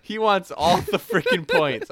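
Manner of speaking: high, loud, laughing through it. Real, not acted. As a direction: laugh while you say it. Laughing at greedy lineup.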